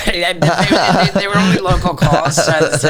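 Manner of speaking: laughing through the words. Real, not acted. Answering a friend about the phone bill, laughing. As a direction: chuckle it out, amused.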